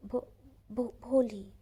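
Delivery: stammering name. Retold or imitated. Bholi finally managing her name, stammering then bursting out. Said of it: "जैसे तैसे अपना नाम बोला".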